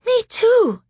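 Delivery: put-on high loud frightened girl; small girl in fright. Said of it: "said Lucy".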